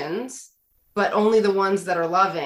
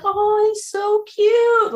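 much higher, louder, slow and gushing; doting son; 6 dB louder, much slower than usual. Retold or imitated. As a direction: coo over the cute robot like a smitten kid. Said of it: "my son was really like".

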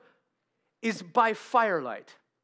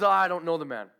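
ordinary delivery

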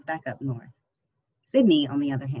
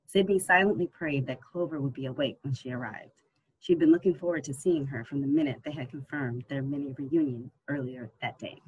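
usual speaking voice

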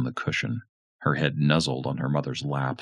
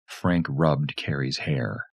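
ordinary delivery

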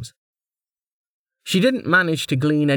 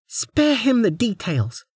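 higher, slow, sharp snap; snapping noblewoman; 13 semitones higher, slower than usual. Acted. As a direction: cut him off coldly. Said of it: "Lady Inar snapped".

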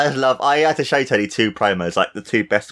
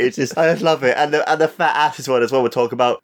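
usual speaking voice

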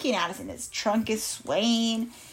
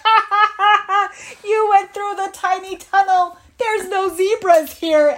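loud, high mocking cackle into speech; laughing hyena; 9 semitones higher, 8 dB louder than usual. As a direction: jeer like a cackling storybook hyena. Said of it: "they were laughing at us".

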